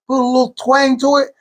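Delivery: ordinary talk, nothing marked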